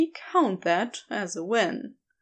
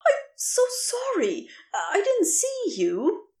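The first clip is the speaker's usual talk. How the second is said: higher, apologetic voice of a kind stranger; flustered stranger; 8 semitones higher than usual. Acted. The stranger apologizes to Crowley after dropping the bucket, flustered and higher. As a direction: fluster out an apology, startled and sorry.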